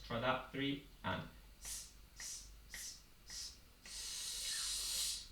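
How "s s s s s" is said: soft s pulses; hissed s. Shown as a demonstration. Teacher demonstrating s sound crescendo. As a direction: hiss the s lightly. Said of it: "double S rather than a sh".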